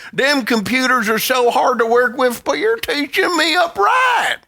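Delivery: high, hammed-up Southern twang; exaggerated Southern yokel, stretching the last words; Southern accent. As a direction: holler it in a mock Southern accent. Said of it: "now in a Southern accent".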